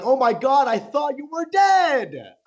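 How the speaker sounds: high, loud, hammed-up astonishment; imitating a friend's shrieked greeting; overjoyed shouting friend. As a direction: holler it across the airport, stretching the end.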